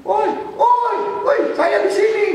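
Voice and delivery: high calling out; calling out lost. Retold or imitated. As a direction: call for the group, lost. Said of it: "kalau udah nyasar, pasti".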